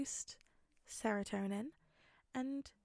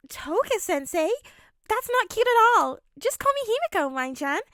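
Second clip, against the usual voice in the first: put-on high, loud, bratty girl voice; a pouty girl refusing a dull title; Toga's pouty voice; 10 semitones higher, 10 dB louder than usual. Toga scolding Izuku for calling her sensei, high and bratty.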